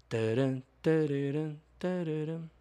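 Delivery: sung tune of the collect-call jingle; hummed collect-call jingle. Retold or imitated. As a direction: hum the phone jingle, rising. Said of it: "A musiquinha da ligação a cobrar".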